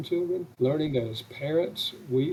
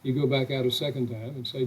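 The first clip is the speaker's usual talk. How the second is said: normal talking voice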